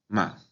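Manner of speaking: "ma" short, in ordinary speaking pitch; neutral tone. Shown as a demonstration. Teacher showing it's like normal talk, neutral short "ma". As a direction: say "ma" with no accentuation. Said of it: "No tiene ningún tono".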